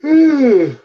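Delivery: big exaggerated sigh, high and loud. Real, not acted. Tired friend sighing mid-chat, loud high sigh. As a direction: let out a long theatrical sigh.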